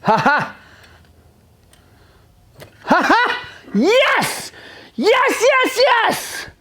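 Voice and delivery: jubilant high whoops of yes; real excited yelling. Real, not acted. Mechanic cheering at the camera over the saved motor, ecstatic high whoops. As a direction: cheer like the engine just came back to life.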